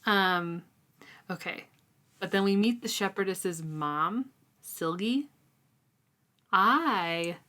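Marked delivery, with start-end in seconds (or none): none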